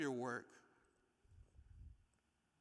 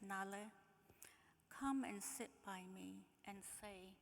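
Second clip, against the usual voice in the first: higher, softer, slow dreamy reading; soft poem reading; 5 semitones higher, 8 dB quieter, slower than usual. Acted. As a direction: let it drift, wistful and quiet. Reads a letter aloud.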